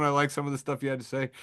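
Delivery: ordinary talk, nothing marked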